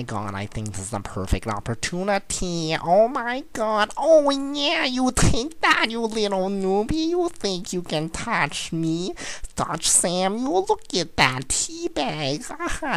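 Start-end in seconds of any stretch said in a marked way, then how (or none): 2.8-13.0 high-pitched, gloating jeer at a newbie; excited taunt. Real, not acted. Gamer mocking the newbie he thinks he beat, high gloating taunt. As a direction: crow at the opponent, voice way up high.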